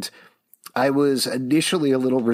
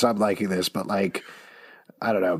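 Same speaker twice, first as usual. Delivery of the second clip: ordinary talk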